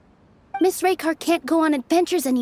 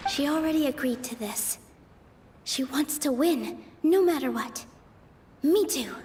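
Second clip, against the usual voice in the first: soft, withdrawn musing; low-volume private worry; quiet inner thought; 3 semitones lower, 8 dB quieter than usual. Real, not acted. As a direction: say it to yourself, under your breath. [name]'s private doubts before next argument.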